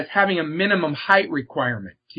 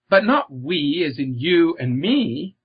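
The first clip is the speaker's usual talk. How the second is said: ordinary talk, nothing marked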